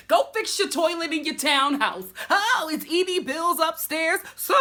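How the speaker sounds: hammed-up shouted insult; imitating Dorinda's read. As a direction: jeer it like a diva dragging her rival. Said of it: "She starts reading her toilet".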